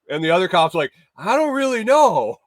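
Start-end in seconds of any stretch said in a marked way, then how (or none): 1.2-2.4 much higher, mock-uncertain reply; quoted unsure cop. Second cop admitting doubt to his partner, mock unsure. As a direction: shrug it off in a raised pitch, imitating him.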